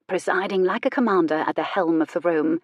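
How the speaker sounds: usual speaking voice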